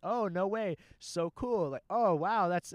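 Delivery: imitating a friend humoring someone; mock-polite amazement, holding back laughter; straight-faced feigned interest. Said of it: "has a straight face on".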